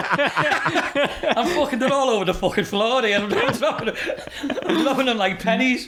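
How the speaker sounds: talking through laughter, pitched high; words breaking up into laughs; laughing. Real, not acted. Teller cracking up over dropping things on the floor, laughing. Cracking up while telling the story.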